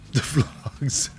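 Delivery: words dissolving into a laugh; real laughing. Real, not acted. Host amused by Duke Hudson's nickname, laughing. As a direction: repeat it through helpless laughter.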